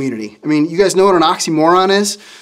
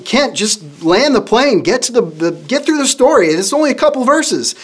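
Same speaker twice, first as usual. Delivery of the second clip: exasperated, higher-pitched mock pleading; retold exasperation; 7 semitones higher than usual. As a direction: recall your impatience, voice climbing as you go. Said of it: "And I'm getting mad".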